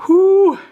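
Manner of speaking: loud high exhale as the tears rise; tearful whew. Real, not acted. Grieving owner on camera lets out a loud tearful whew. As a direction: breathe out hard against the crying. Crying over dead pet.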